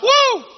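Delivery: high, drawn-out "woo!"; awed whoop. Acted. Preacher reacting as John hearing this stuff, amazed high whoop.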